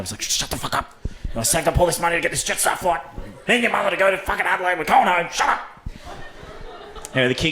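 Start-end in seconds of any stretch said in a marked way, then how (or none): 0.2-0.9 hissed whisper, tense and soft; imitated hushing dad. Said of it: "trying to tell his kid to shut up". 1.4-5.6 higher, fast exasperated rant; imitated scolding dad. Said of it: "trying to tell his kid to shut up".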